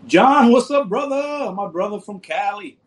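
loud, high, hyped-up shout-out; excited greeting. Real, not acted. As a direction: greet him like a long-lost friend. Greeting a viewer on stream.

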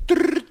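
high trilled phone ring; playful trilled ringtone; imitated phone ring. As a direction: roll the tongue like a ringing telephone. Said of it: "Στα δύο τρρρ απαντάει ο τηλεφωνητής".

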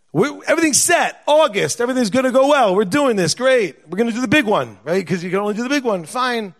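high, punchy excited run; upbeat confidence. Retold or imitated. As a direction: rattle off the good news, all fired up. Acting out their confidence before news.

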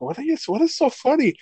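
put-on high, puzzled little girl voice; daughter's voice. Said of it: "my daughter was just like".

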